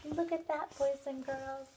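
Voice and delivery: ordinary delivery, nothing marked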